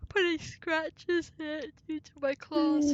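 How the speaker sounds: words stretched by a yawn. Real, not acted. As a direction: talk through a big yawn. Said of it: "I'm yawning".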